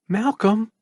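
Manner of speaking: high gentle mother's voice calling; soft feminine voice. A narrator's reading. Mother's voice calls to her son, soft. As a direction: call his name softly, like a mother. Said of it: "a soft feminine voice said".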